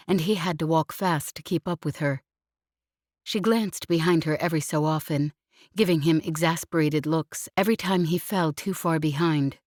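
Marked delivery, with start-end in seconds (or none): none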